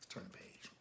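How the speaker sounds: plain reading voice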